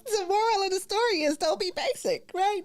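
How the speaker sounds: high words through laughter; laughing. Real, not acted. Host cracking up after the chicken ad, laughing speech. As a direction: laugh your way through the line.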